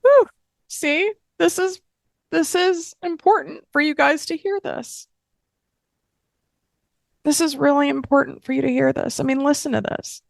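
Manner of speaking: high, tight voice of holding back tears; choked up. Real, not acted. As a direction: push through real tears to make the point. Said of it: "Why am I getting so emotional".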